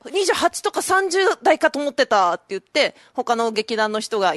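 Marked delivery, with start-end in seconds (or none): 0.1-2.3 higher-pitched, astonished blurt; retold surprise. Re-enacting astonishment at the manager's age, high-pitched surprise. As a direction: react in disbelief, pitched well up. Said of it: "なんでお前が驚いてる".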